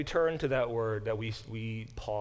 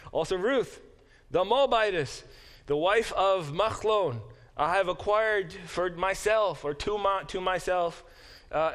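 formal declaration; 5 semitones higher, 3 dB louder than usual. Acted. Reading Boaz's declaration aloud.

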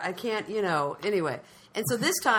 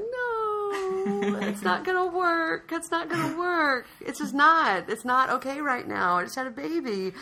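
high, drawn-out comic wail; hammed-up near-tears protest; mock panicked whine; 11 semitones higher, 3 dB louder, slower than usual. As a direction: plead and whine, climbing into a squeal. Retold panicked plea about nudity.

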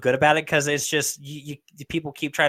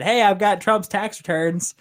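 mock excited announcement; 8 semitones higher, 4 dB louder, slower than usual. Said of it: "Rachel Maddow said".

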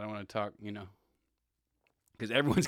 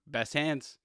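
sarcastic, building higher and louder; each repeat more mocking and louder, last one slow; sneering at a receiver dropping passes; mocking praise; 10 semitones higher, 10 dB louder, slower than usual. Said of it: "I was just thinking, like".

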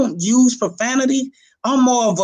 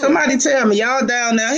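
usual speaking voice

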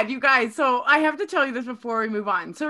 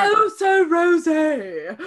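slow, loud, exasperated Oh So Rosé; corrected pronunciation; 4 semitones higher, 5 dB louder, much slower than usual. Shown as a demonstration. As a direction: spell out Oh So Rosé slowly and loudly. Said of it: "Yeah, that's better".

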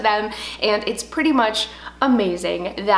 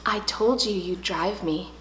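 put-on softer, lower smug little boy; smug kid; 4 semitones lower, 7 dB quieter than usual. Son gloating after the bus leaves, soft smug. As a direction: gloat it quietly like a kid who won. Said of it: "he's like".

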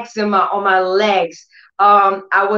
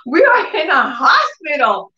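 mock incredulous; 10 semitones higher than usual. Mother baffled at daughter asking for money, high incredulous cry. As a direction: play the baffled mom, pitched up. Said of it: "I said".

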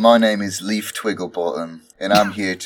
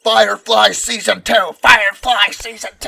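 excited shout; 13 semitones higher, 4 dB louder than usual. Real, not acted. Shouting fan demand.

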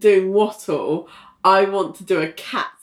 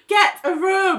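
high teasing shout; 11 semitones higher than usual. Acted. Calling out to the couple.

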